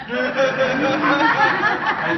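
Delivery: bright burst of laughter; a real amused laugh. Real, not acted. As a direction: laugh out loud at the memory. Laughing at David bossing.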